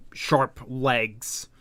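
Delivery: ordinary delivery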